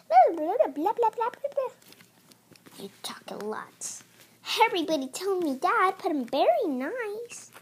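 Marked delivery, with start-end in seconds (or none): 0.1-1.7 mock chatter. Mocking chatter. 2.8-4.0 whisper. Pretend. Whispered remark.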